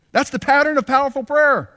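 high, loud proclamation of the point; proclaimed. Shown as a demonstration. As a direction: hammer the lesson home. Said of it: "And that's the pattern of powerful prayer".

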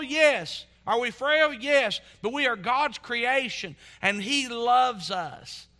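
impassioned preaching. Real, not acted. Preacher assuring congregation they are on God's heart, impassioned. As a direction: preach it out with rising conviction.

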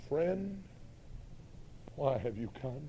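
low, soft and unhurried; quiet, measured appeal to a betrayer; Jesus, gently. Retold or imitated. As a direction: speak it softly as Jesus would. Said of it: "Listen to Jesus. He said".

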